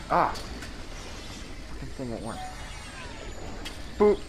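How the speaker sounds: ordinary talk, nothing marked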